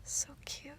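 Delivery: very soft, half-whispered endearments; tender murmuring. Acted. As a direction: murmur adoringly to your pet, barely voiced. Murmured endearments while cuddling pet.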